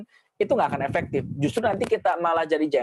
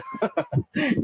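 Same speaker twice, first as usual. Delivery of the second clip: high laugh; 10 semitones higher than usual. Real, not acted. Laughs after scolding demo.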